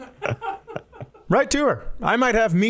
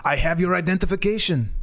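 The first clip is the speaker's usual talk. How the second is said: French caller voice; 4 semitones higher than usual. Retold or imitated. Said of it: "And he goes".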